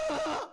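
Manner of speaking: loud, high hammed-up wail; mock wail. Preacher acting David's sob for kids, loud mock wail. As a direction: burst into a mock crying wail. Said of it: "하면서 다윗은 하나님께 울면서".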